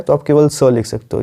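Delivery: ordinary talk, nothing marked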